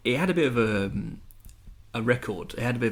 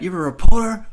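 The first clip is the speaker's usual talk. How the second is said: high, loud mock outburst; mock angry shout; 12 semitones higher, 15 dB louder than usual. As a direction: bark it like an offended star. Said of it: "saying, like".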